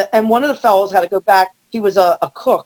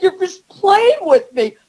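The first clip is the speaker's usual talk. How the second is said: exasperated; 9 semitones higher, slower than usual. Retold or imitated. Exasperated at the door.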